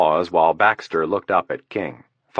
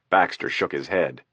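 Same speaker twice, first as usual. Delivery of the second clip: plain reading voice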